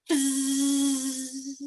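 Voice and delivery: a long buzzing fizz sent far away. Shown as a demonstration. Coach demonstrates for the group, a long far-off fizz. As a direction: buzz like a bee flying off. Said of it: "you can go far away, which means it's very loud".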